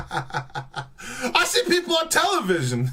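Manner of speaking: slow, laughing parody of the line; mock quote through laughter; laughing mockery. Mocking the clip while laughing.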